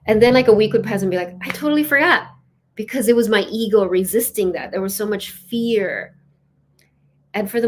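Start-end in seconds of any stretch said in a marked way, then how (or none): 1.4-2.3 high, louder mock dismay; sudden realisation. Speaker remembers the morning prayer a week late, dismayed. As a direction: act out realising it, pitch jumping up.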